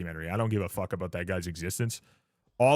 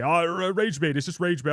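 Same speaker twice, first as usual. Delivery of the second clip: higher, flustered imitation of a scared troll; mock-nervous backpedaling, pitched up; mock trembling; 6 semitones higher, 4 dB louder than usual. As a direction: blurt it like a panicky excuse, hammed-up. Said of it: "like, legitimately tremble".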